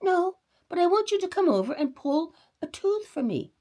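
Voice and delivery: sly request. A narrator's reading. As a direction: wink through the words. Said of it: "said Uncle Wiggily, blinking".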